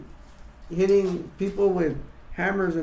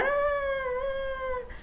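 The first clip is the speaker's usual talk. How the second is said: high-pitched mock scream; 5 dB quieter than usual. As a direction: shriek as if struck. Sally being hit.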